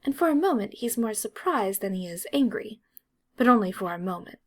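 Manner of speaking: usual speaking voice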